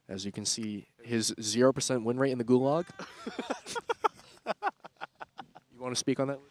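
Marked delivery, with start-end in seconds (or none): 2.9-4.1 high breathless laugh. Real, not acted. Laughing at Carpy's Gulag stat.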